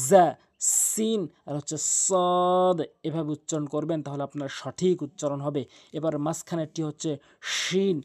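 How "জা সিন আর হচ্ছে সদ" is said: slow "জা", "সিন", "সদ", tongue tip between the closed teeth; tongue-tip sibilants. Shown as a demonstration. Teacher showing three like letters, slow tongue-tip "জা", "সিন", "সদ". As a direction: draw out the hiss of "জা", "সিন", "সদ". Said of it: "জিহ্বার আগাটি নিয়ে উপরে নিচে".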